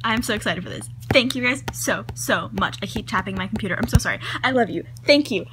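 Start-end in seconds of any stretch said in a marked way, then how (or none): none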